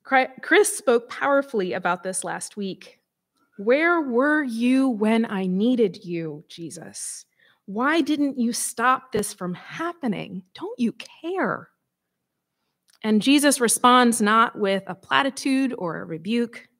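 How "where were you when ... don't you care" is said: slow, pained questions, whispered name; anguished plea. Acted. Mourner asking Jesus why, slow anguished plea. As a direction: ask him slowly through the hurt.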